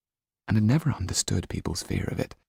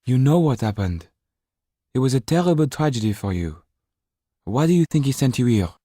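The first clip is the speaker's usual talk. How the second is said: higher, louder pressing tone; Mrs Laxton voiced; 7 semitones higher, 5 dB louder than usual. Mrs Laxton presses Jan about his past, higher and louder. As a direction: press him for the truth.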